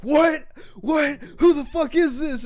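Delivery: high, loud disbelief; dad freaking out. Retold or imitated. Prank victim's dad to unknown caller, freaking out. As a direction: imitate an outraged dad shouting down the phone. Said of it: "he's, like, all freaking out".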